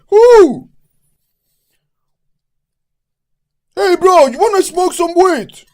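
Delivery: high, shouted hyped-up Mexican English; over-the-top Mexican party holler; energetic Mexican accent. Acted. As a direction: whoop and yell it with a rowdy Mexican accent. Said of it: "energetic or something".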